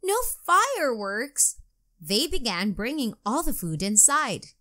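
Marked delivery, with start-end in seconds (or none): 0.0-1.6 disappointed groan. A narrator's reading. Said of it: "Katie groaned".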